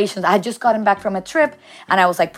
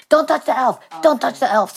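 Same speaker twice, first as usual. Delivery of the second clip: high, repeated parental warning; mock nagging; 6 semitones higher than usual. Mother nagging her son about the elf, mock-scolding. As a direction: imitate a parent nagging on a loop.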